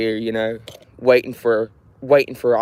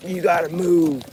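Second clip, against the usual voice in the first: higher, pushy voice telling them to move; mock order to move; 6 semitones higher than usual. Man recalling being told to move at the auction, mock bossy order. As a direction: bark out the order to move along. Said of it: "they were like".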